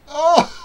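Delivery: high, loud laughing "oh"; delighted whoop. Real, not acted. Reacting with delight to play.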